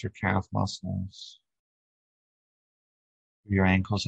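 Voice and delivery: calm, even meditation guide with long pauses; unhurried body-scan voice, settling at phrase ends; guided meditation. Acted. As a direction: lead them gently through breath and body.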